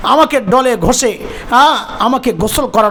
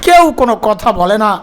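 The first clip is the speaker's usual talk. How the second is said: ordinary talk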